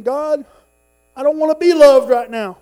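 high, loud complaint to God; acted loud protest. Grumpy man answering God's love, loud protest. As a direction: cry out in exasperated refusal.

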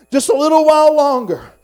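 high, very loud triumphant cry; full-force preacher's shout. Acted. Weary believer looking to his heavenly home, triumphant shout. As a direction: belt it out to the whole church.

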